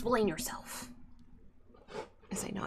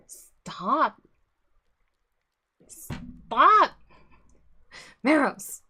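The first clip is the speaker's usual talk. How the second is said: loud sharp yell at the cat; sharp shout; 8 semitones higher, 15 dB louder, much slower than usual. Real, not acted. Shouting at the cat to stop it interrupting, loud and sharp. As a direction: bark the cat's name to stop it.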